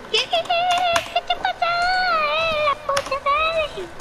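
high, slow panicked cries; panicked yelp. Real, not acted. Boy panicking at a jump scare in the game. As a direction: shriek in real fright.

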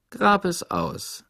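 slow, drawn-out guard voice; stern guard. A narrator's reading. Mister Sir looms over Stanley in his hole, slow and suspicious. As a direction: lean over him, slow and knowing.

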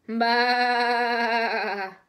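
a sing-song "baa"; sung bleat. Shown as a demonstration. Teacher answers her own quiz with a sing-song bleat. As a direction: bleat it out like a sheep. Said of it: "how about the sheep?".

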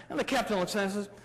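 ordinary talk, nothing marked

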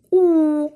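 У drawn out, clearly separate; second sound alone. Shown as a demonstration. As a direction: isolate the sound У. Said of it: "другий".

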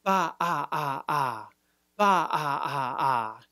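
ba then repeated ah pulses; throat stop drill. Shown as a demonstration. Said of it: "repeat that ah sound".

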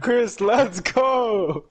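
drawn-out cheer. Real, not acted. Cheering teammate.

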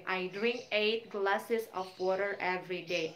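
slow, careful model sentence for students. Shown as a demonstration. Teacher modelling a daily practice sentence, slow. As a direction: spell it out slowly like a teacher. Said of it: "misalnya Miss ambil yang rutin".

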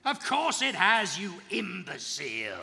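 loud, slow contemptuous snap; a slow, loud villain's insult; scornful shout. Acted. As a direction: sneer it at the fool, loud. Villain mocks the heroes.

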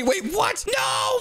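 high, loud cry of No; shocked shout. Real, not acted. Brother yelling as his sister wins the arm wrestle, high shout. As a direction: yelp as you lose.

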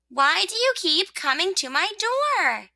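high-pitched complaint; exasperated rosebud. Acted. Rosebud to the visitor at her door, exasperated.

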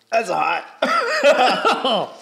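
pained shout. Real, not acted. Eating a very hot pepper.

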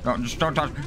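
ordinary talk, nothing marked

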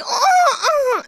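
high, laughing mock cries; mock wail. Teasing friend on the street with high mock cries. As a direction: tease her with fake pain sounds. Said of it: "so then I was like".

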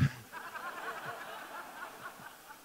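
quiet laugh through the breath; soft breathy laugh. Real, not acted. Preacher laughing softly at his own aside. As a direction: let a soft, breathy chuckle escape.

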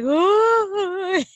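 a hammed-up child's wail; mock startled wail. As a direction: voice the toddler about to bawl. Toddler starting to cry.

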